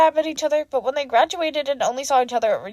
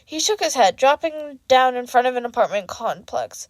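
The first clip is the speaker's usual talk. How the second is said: ordinary delivery, nothing marked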